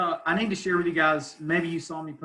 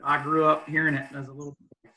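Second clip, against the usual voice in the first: usual speaking voice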